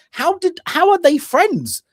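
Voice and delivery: high, baffled question; can't believe these people are friends; incredulous. Real, not acted. Baffled at the film's friend group, voice jumping high.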